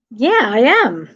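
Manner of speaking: slow British answer that sounds like a question; mock-British lilt on a plain reply; British questioning tune. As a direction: swoop the pitch up and down like an exaggerated Brit. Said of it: "Whenever you're not asking a question".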